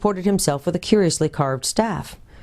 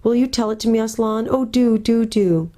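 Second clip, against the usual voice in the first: lightly voiced eager girl, higher; Lucy pleading; 7 semitones higher than usual. Lucy begs Aslan to tell the story, eager and higher. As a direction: beg him sweetly for the story.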